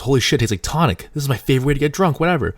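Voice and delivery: high, rising acted excitement; delighted amazement. Second guy tasting tonic in the apple, acted excitement.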